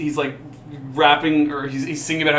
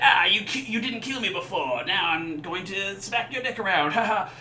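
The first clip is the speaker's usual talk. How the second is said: orc taunt; 5 semitones higher, 4 dB quieter than usual. Retold or imitated. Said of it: "he'll come back and be like".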